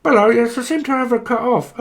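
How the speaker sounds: high, loud mock phone voice; breaking-up caller. Pretend caller losing the connection, high and loud. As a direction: call out over a dodgy line. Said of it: "It's a bit of a bad signal".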